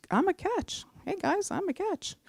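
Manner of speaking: high, soft, teasing boast; playful self-promotion. Acted. Woman jokingly telling the guys she's single, playful high soft voice. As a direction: toss it out lightly, high and small. Jokingly advertising herself to listeners.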